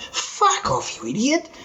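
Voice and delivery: high-pitched mock outrage; incredulous brush-off. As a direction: dismiss a prank caller in disbelief. Dismissing supposed prank caller.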